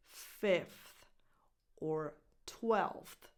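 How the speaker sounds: "fifth" and "twelfth" slowly, each f and th sounded; drawn-out endings on "fifth" and "twelfth"; careful full pronunciation. Shown as a demonstration. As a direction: take time over f-th in "fifth" and "twelfth". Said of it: "if you have the time".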